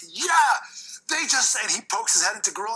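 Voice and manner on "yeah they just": high hyped yell; fired-up Taker. Retold or imitated. Taker pumped at the slap, high and hyped. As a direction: burst out fired up. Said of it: "He's like".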